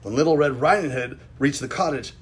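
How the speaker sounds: plain reading voice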